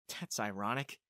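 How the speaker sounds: low, soft aside; under the breath. A narrator's reading. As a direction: drop it out low, to yourself. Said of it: "I said under my breath".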